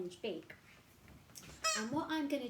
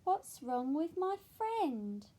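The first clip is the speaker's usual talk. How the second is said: worried penguin; 6 semitones higher, 6 dB louder, slower than usual. Acted.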